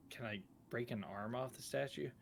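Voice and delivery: ordinary talk, nothing marked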